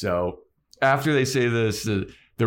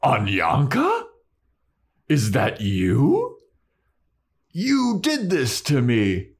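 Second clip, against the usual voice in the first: troll voice; 4 semitones higher, slower than usual. Acted. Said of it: "the troll's like".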